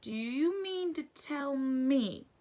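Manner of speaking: slow building anger; growled. A narrator's reading. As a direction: draw it out in mounting disbelief. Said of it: "he growled at the Dursleys".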